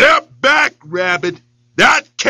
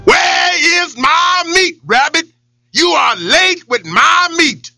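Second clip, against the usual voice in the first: angry lion roar; 11 semitones higher, slower than usual. Acted. Lion demands his meat from Rabbit, furious shout. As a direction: bellow for the late meat. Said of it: "Boss Lion was mighty angry".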